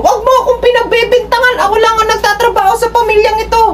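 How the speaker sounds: high, reproachful wife voice; resentful wife. Retold or imitated. Wife reproaching husband that only she works, high resentful voice. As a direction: throw it back at him from up high. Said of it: "parang nanunumbat na".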